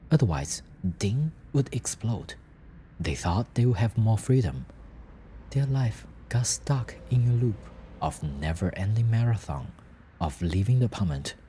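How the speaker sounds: usual speaking voice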